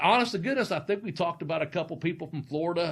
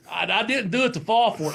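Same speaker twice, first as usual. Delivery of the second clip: normal talking voice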